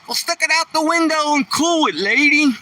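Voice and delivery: high, put-on voice of a smart-aleck guy; wisecracking passenger. Guy across the bus mocking the lady in a high put-on voice. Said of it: "guy sitting across says".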